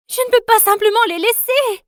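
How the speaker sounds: very high, loud anguish; a daughter crying out high and loud for her family; desperate protest. Acted. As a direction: struggle against being held back. Held back from her family.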